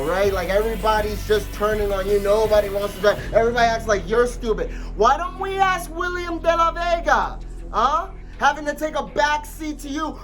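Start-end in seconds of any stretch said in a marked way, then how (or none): none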